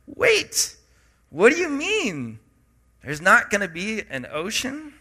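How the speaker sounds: high, startled wait, what do you mean; shocked disbelief. Retold or imitated. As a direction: react like the news just hit you. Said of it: "And I read this and I go".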